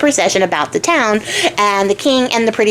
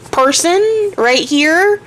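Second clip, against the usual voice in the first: incredulous; 6 semitones higher, slower than usual. Retold or imitated. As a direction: voice shoots higher and slows right down in disbelief. King stunned by ugly sister.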